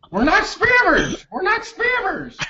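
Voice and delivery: squeaky high mock denial. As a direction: shoot way up, falsetto. Mock protest.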